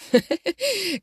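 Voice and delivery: high-pitched short giggle; laugh. A narrator's reading. Jo amused by her mother's question, laughing. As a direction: burst into a light high laugh. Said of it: "aggiunse con una risata".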